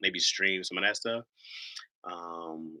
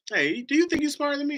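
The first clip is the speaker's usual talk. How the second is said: retold question pitched way up; high-pitched questioning; 4 dB louder than usual. Husband asks his wife who is smarter, high-pitched challenge. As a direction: ask it high, as a playful challenge.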